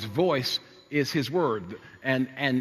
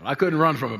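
usual speaking voice

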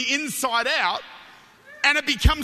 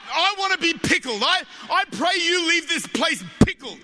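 raised, higher-pitched preacher's voice; fervent preaching; 5 semitones higher than usual. Real, not acted. Preacher praying his congregation leaves filled, fervent preaching. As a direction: preach it out with mounting force. Preaches at rising full force.

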